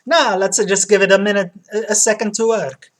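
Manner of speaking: loud Italian barber; Italian accent. Acted. Barber finishing the hair treatment, Italian accent. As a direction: play a hearty Italian barber. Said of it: "platinum blonde with Italian accent".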